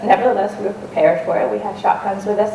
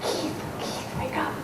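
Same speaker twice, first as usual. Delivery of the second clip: hushed, breathless whisper to wake a sleeper; urgent whisper; 14 dB quieter, slower than usual. Retold or imitated. Whispering urgently to wake the sleeping guide in the tent. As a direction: whisper his name so no bear hears.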